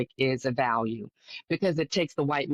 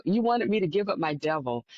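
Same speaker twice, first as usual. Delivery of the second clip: normal talking voice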